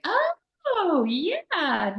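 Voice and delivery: very high, drawn-out dawning "oh"; sudden realization. Acted. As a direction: act out the penny dropping, pitch soaring.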